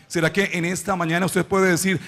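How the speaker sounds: normal talking voice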